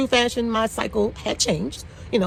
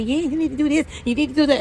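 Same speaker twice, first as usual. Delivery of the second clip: bossy know-it-all advice, higher and louder; imitating a nosy sales rep; pushy chatty rep; 7 semitones higher, 3 dB louder than usual. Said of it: "one of those chatty patties".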